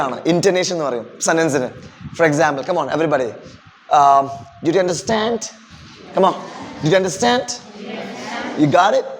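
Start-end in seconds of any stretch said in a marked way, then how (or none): 4.6-5.4 "did you understand" rising at the end; question intonation. Shown as a demonstration. Teacher demonstrates sentence music to class, questioning tune. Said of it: "ചോദ്യത്തിന് ചോദ്യമായിട്ടും". 6.8-9.1 "understand" and "got it" each rising at the end; question intonation. Shown as a demonstration. Teacher models questions for the class, rising tune. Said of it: "ചോദ്യത്തിന് ചോദ്യമായിട്ടും".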